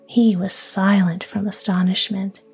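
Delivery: ordinary delivery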